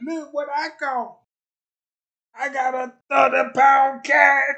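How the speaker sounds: loud, high, bragging about the catch; proud show-off shout. Retold or imitated. Bragging to the world about his big catch, loud and proud. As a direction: hold it up and shout it to everyone. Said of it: "show the world".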